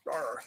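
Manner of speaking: low, soft bear growl; small mock growl, low and subdued. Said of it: "That was my bear impression".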